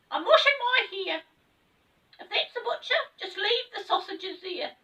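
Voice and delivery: very high, loud called-out voice; a high creature voice; voice behind door. Acted. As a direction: call out from inside the house.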